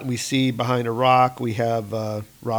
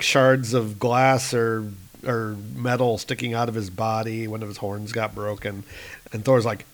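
plain reading voice

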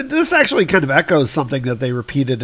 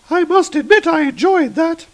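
acted god in a much higher pitch; put-on high voice; 3 dB louder than usual. A god enjoying the fight, high put-on voice. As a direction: lift it into a high character voice.

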